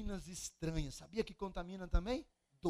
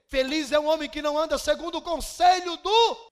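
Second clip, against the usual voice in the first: shouted scripture; 8 semitones higher, 17 dB louder than usual. Real, not acted. Psalm read shouting.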